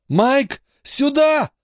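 high, urgent scream; yelling for help. A narrator's reading. Boy calls his friend from upstairs, high urgent yell. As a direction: call out desperately at a high pitch. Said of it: "вопил Дейл".

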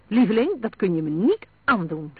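loud, indignant protest. A narrator's reading. Ina protesting to her husband's refusal, indignant. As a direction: start high and loud, protesting emphatically. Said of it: "zei ze verontwaardigd".

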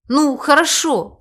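slow, high-pitched concession; giving in. A narrator's reading. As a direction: yield with reluctance. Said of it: "сдался Лавир".

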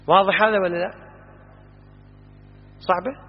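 normal talking voice

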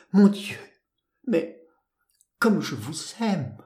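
overcome, break the declaration up with pauses; stammered declaration. A narrator's reading. Dizzy lover embracing her, stammered declaration. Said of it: "ces mots balbutiés et indistincts".

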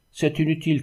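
a mild, high exclamation; narrated cry. A narrator's reading. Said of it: "cria l'un des grands".

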